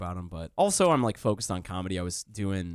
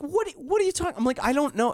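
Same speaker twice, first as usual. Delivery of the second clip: high, cracking in disbelief; stunned he even says that; baffled disbelief. Retold or imitated. Baffled by roommate's complaint.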